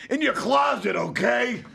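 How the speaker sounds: high, loud blurted confession; exasperated shout. Retold or imitated. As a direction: snap it out, fed up. Son finally confesses under badgering.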